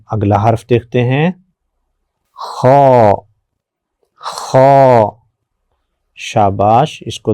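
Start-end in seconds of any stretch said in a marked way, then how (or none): 2.3-5.1 خو with a rasping, heavy throat sound; thick kho. Shown as a demonstration. Teacher modelling the thick throaty sound of خو. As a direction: scrape خو thickly from the back of the throat. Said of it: "تھوڑی موٹی آواز ہے".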